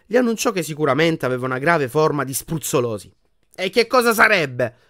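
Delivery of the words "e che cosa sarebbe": loud, higher-pitched irritated demand; furious question. A narrator's reading. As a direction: snap back, offended. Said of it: "domandò furioso".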